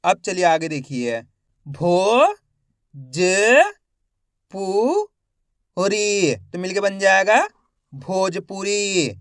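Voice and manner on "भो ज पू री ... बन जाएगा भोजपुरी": slow spelling drill, each syllable held with a rising end; stretched syllables for beginning readers; letter-by-letter sounding out. Shown as a demonstration. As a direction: sound out each letter, then blend the word. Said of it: "एक अक्षर का उच्चारण करके".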